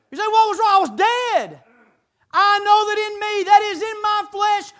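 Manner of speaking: loud, high, driving sermon voice; impassioned preaching. Real, not acted. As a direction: preach it hard from experience. Preacher at full force confessing.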